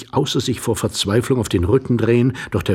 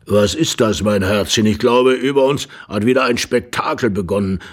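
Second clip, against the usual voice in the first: put-on a drowsy old man's voice, louder; sleepy old man; 5 dB louder than usual. A narrator's reading. The old husband waking up asking his darling, sleepy. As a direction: sound half woken from a doze. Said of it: "mit schläfriger Stimme".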